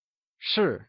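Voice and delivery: 是 with a clean falling pitch; teacher's falling-tone model of 是; fourth tone. Shown as a demonstration. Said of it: "also known as the fourth tone".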